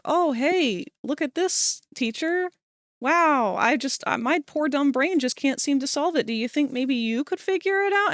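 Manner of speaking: high, sweet fake-dumb student voice; mock helpless student. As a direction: play dumb to flatter the teacher. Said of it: "and be like".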